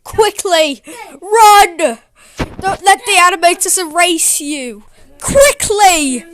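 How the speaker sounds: loud frantic yelling; shouting. Pretend. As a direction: yell at the dog to run. Yelling at cartoon dog to run.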